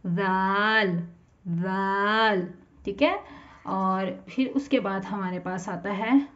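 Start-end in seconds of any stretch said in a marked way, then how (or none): 0.1-2.5 जाल very slow, tongue to upper teeth, gently; soft zaal. Shown as a demonstration. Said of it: "इसको सख्ती से नहीं, नरमी से पढ़ेंगे".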